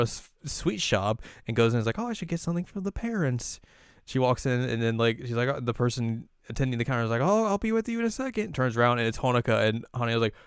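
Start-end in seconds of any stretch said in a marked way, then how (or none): 2.0-3.6 high, light quoted thought; voiced girl's musing. 7.2-8.5 high, singsong customer-service voice; shop attendant. Retold or imitated.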